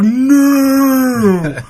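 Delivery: long, high, loud drawn-out cry; panicked shouted no. Retold or imitated. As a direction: stretch it into a big hammed-up yell of protest. Lawyers in car crying out.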